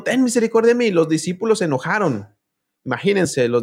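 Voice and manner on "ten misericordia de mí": mock cry for mercy, pitched much higher; pleading cry. Woman crying out behind Jesus, high pleading plea. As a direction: beg aloud, voice lifted high. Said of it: "Y venía gritando".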